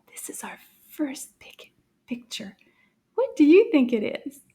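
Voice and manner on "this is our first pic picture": whispering. Acted. Teacher's hushed aside revealing clue.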